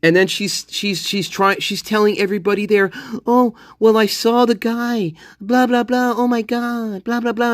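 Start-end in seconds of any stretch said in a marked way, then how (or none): 3.3-7.5 higher, hammed-up teary rambling; mocking hysterical witness. Mocking Dylan telling everybody she saw the guy, hammed-up fake crying. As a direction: parody her fake distraught performance. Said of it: "and she's crying".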